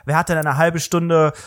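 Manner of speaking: normal talking voice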